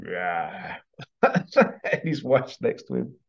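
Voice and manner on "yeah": low, lightly voiced pirate; a soft, knowing pirate "yeah", pitched down; hint of an old sea rogue, low and quieter; pirate character. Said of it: "like this pirate character".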